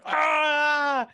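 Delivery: high, loud startled scream; scared scream. Retold or imitated. Imitating Garth woken by the prank, loud high scream. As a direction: shriek like a kid woken in terror. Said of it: "All I can think of is Garth going".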